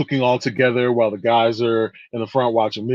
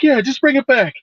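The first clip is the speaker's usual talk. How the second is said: high, breezy mother reply; oblivious mom; 11 semitones higher than usual. Retold or imitated. Mother casually lending the knife, high and breezy. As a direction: wave it off like a clueless mama. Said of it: "And she's like".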